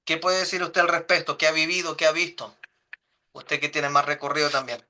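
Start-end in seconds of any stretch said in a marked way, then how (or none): none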